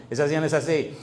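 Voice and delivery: normal talking voice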